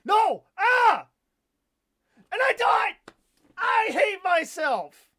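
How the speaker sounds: high, full-force scream of frustration; raging, drawn-out high shout; frustrated screaming. Real, not acted. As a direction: scream it out in anguish at losing. Dies in game, yells in frustration.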